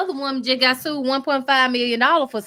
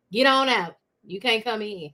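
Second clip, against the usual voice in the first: ordinary talk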